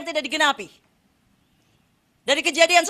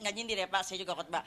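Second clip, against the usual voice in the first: much softer, lower, half-swallowed aside; quiet joking aside; 8 semitones lower, 11 dB quieter than usual. Real, not acted. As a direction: murmur it as a joke to the pastor. (bisik bercanda kepada pendeta)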